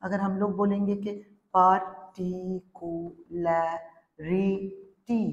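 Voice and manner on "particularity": "particularity" very slowly as par, ti, cu, la, ri, ty; six drawn-out beats on "particularity"; syllable by syllable. Shown as a demonstration. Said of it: "अगर ऐसे बोलेंगे".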